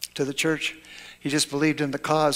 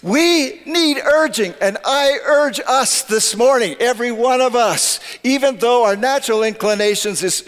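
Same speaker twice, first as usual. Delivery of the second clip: fervent preaching; 6 semitones higher, 3 dB louder than usual. Acted. Pastor urging congregation.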